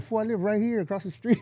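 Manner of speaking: high, amused reveal; telling a friend he lives across the street, amused; retold reveal. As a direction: deliver the punchline gleefully. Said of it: "I'm like".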